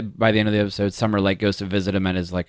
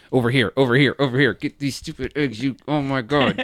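frustrated game callout; 3 semitones higher than usual. Retold or imitated. Spamming in-game button, frustrated.

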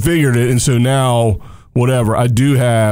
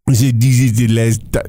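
a jokey French accent, lightly put on and a bit lower; mock French accent; 4 semitones lower than usual. Host jokingly claims French roots, mock French accent. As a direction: slip briefly into a playful French accent. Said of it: "that's my French accent".

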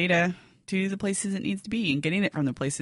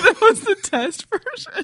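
surprised echo; 12 semitones higher, 7 dB louder, slower than usual. Real, not acted. Echoing co-host's bad news.

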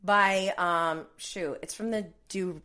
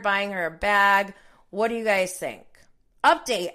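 normal talking voice

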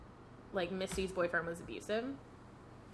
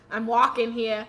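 louder mock New Yorker accent; 3 semitones higher, 9 dB louder than usual. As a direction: put on a light New York street accent. Joking New Yorker impression.

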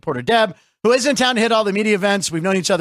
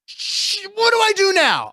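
high, excited voice of the climber on top; 12 semitones higher than usual. Retold or imitated. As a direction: play the man who just reached the top, high and giddy. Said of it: "He's like".

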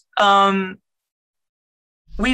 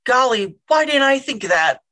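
higher-pitched mock admiration; sarcastic; 4 semitones higher than usual. Child humouring dad's survival advice, sarcastic. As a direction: gush with fake awe at an obvious idea.

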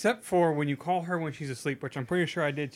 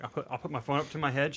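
ordinary delivery

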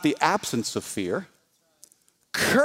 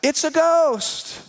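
higher, slow terrified cry; frightened cry; 9 semitones higher, slower than usual. Acted. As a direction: cry out as scared disciples in the storm. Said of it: "they cried out".

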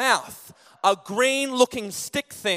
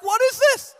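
much higher and louder, incredulous; shocked outrage; 8 dB louder than usual. Retold or imitated. Complaining to the cafe lady, a loud shocked question. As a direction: demand it in disbelief. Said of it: "I'm like".

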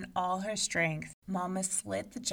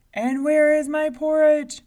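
giant demanding; 9 semitones higher, 15 dB louder than usual. A narrator's reading. Giant at the door demanding his porridge, booming.

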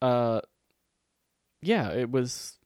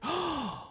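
gasp; 4 dB quieter than usual. Retold or imitated. Shocked at learning of vaccine.

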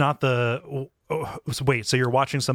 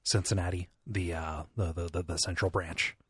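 soft, hushed library voice; stage whisper; 3 semitones lower, 7 dB quieter than usual. Acted. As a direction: keep it down, you're in a library. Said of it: "you're gonna have to whisper a little louder".

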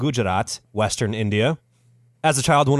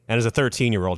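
ordinary delivery

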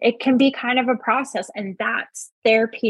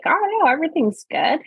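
put-on cheerful; 9 semitones higher than usual. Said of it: "feel like they have to put on this".

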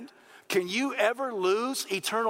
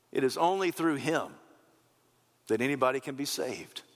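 low, measured, with long pauses; hushed preaching; 6 semitones lower than usual. Real, not acted. Preacher on life through the shepherd, hushed and earnest.

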